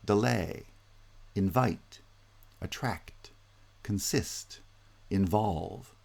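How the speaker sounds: "delay", "invite", "attract", "consist", "involve", each stepping up onto the second syllable; stress on final syllable. Shown as a demonstration. Lecturer listing verbs, final stress on "delay", "invite", "attract", "consist", "involve". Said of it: "verbs often have final stress".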